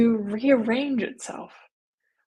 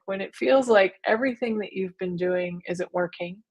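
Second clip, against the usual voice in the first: usual speaking voice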